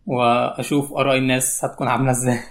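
normal talking voice

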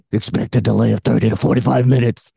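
a hint of gravelly Batman growl. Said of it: "What if Batman was on there".

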